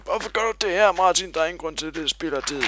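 mock high, loud viewer voice; imagined viewer. Imagined viewer asking Martin why, in a mock high loud voice. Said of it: "Nu tænker du måske".

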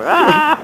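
sudden high yelp of fright; startled cry. Real, not acted. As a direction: jump and yelp. Startled scream while riding.

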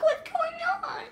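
squeaky high pet voice; high dog voice. Pretend. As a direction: voice the confused dog, high and slow. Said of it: "It's like".

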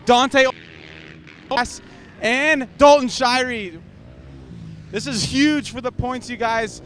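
loud, urgent and high; shouting the big news over the noise; excited pit report. Real, not acted. Pit reporter with major shakeup.

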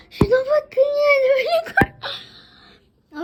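excited. Real, not acted. Overwhelmed by the snowfall.

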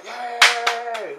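high, loud cheer; crowd cheer. Retold or imitated. Crowd celebrating the win, high and loud.

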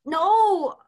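loud, sharp no; exasperated no. Acted. Teacher loudly rejecting the student's garbled French. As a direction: shut the wrong answer down hard.

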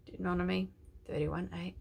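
ordinary talk, nothing marked

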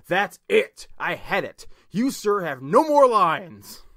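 rising furious outburst, slowing down; angry. Acted. Narrator loses temper at character.

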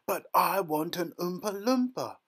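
put-on high, loud spoilt-child wail; screamed demand from a spoilt girl; screaming tantrum. A narrator's reading. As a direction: throw a full bratty tantrum. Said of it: "screamed Veruca".

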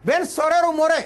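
mock outrage of an aggrieved father; indignant father. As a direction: complain loudly about your son. Father accuses son before rabbi.

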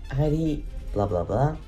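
normal talking voice

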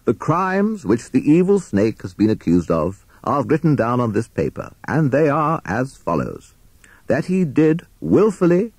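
puffed-up prosecutor declaiming; pompous courtroom oratory. A narrator's reading. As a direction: read the charges with great self-importance. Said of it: "his loudest and most pompous voice".

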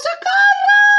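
very high, loud cry for help; panicked scream. Acted. Grandmother fleeing the wolf, screaming in high panic. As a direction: shriek for help in hammed-up terror.

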